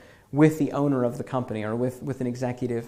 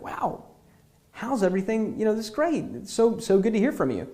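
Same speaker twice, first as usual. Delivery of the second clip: brighter and higher, happy to hear from them; light up at an old friend's voice; excited; 7 semitones higher than usual. Pretend. You greet an old friend calling after years, pitched up and excited. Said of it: "And you're excited, like".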